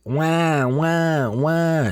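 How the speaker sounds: put-on high wailing baby cries; baby crying. A narrator's reading. Narrator mimics the baby's cries, high wailing. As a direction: wail like a crying infant. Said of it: "cut off by the baby crying".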